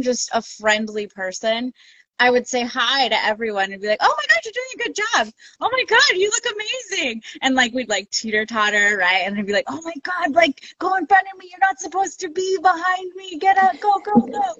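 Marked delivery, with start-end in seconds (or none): 4.0-7.2 high-pitched retold cheering; excited trail cheerleader; gushing encouragement. Retold cheering of other racers. 9.7-14.5 high-pitched retold cheering; excited urging.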